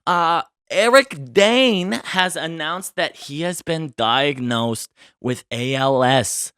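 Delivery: ordinary delivery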